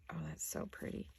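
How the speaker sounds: soft whisper, half to herself; hushed delighted aside over pretty lace; whispered admiration. Real, not acted. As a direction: breathe it out admiringly. Admiring lace while crafting.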